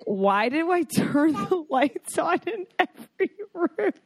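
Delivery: high-pitched, baffled mock self-questioning; baffled self-quote. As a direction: act out asking yourself why, voice pitched high. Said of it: "I'm like".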